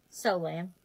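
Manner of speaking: low, quiet dismissal; unimpressed. Acted. Teenage sister scorning a gumball, unimpressed.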